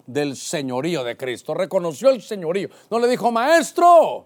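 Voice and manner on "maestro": loud, high mock call. Preacher voicing the title Peter rejected, calling out. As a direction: shout it high, as the wrong title.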